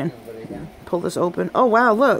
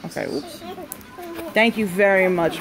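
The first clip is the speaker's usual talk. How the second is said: usual speaking voice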